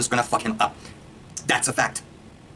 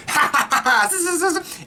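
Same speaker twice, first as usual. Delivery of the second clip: high mock villain laugh with buzzing zaps; Kano laughing; slower than usual. Kano in the trailer picture, cackling high. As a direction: cackle up high like a cartoon villain firing a laser. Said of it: "the picture of Kano going".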